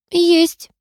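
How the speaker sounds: very high little squeak. A narrator's reading. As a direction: pipe up in a tiny high voice. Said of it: "пискнула Лиса".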